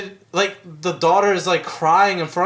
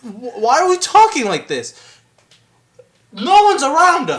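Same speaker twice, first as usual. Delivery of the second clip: high, loud, incredulous protest; exasperated objection; 11 semitones higher, 8 dB louder than usual. Retold or imitated. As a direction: raise your voice at the absurd whispering. Mocks whisperers by speaking up.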